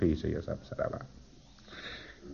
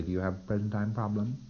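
soft auditor question; 9 dB quieter than usual. Shown as a demonstration. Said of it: "And you say".

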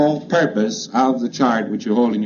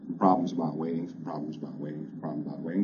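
rote repetition, fading away; 6 semitones lower, 11 dB quieter, faster than usual. Shown as a demonstration. Auditor repeating the command to a pre-clear, fading to a soft murmur. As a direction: repeat the command, each time softer, as a murmur. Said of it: "Got this rather flat".

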